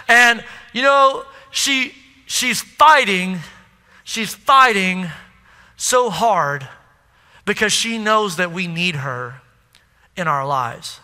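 slowing and fading as the voice breaks; retelling a friend's words about his sick mother, voice breaking. Retold or imitated. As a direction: let it crack and drop away to almost nothing. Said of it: "he's breaking up".

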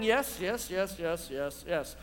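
congregation's agreement, trailing off quietly; fading yes. Acted. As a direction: let the yeses fade away.

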